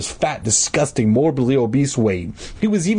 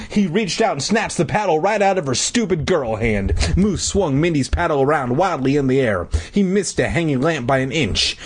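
ordinary delivery, nothing marked

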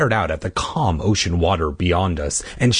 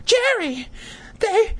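very high, stammering squeal of terror; terrified cry; slower than usual. Acted. Grabbed sister cries to brother, terrified squeal. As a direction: wail it high and drawn out, hammed up. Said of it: "I heard her terrified cry".

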